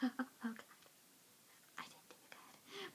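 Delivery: soft whispered sigh of defeat; whispered disappointment. Retold or imitated. Friends without awards, dejected whisper. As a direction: breathe it out, dejected. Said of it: "empty-handed like".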